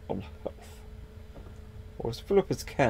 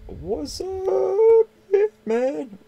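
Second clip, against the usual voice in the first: high squeaky greeting, much louder; 7 dB louder, much slower than usual. Pretend. Squeaky high greeting to a passing stranger. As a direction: squeak it out like a cartoon. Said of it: "my voice is getting a bit squeaky there".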